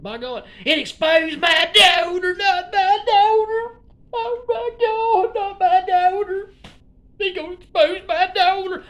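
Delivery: high, loud hammed-up wail, slow and stretched; fake-sob the plea like a soap opera; mock wailing. Vlogger mock-wailing about his daughter being exposed.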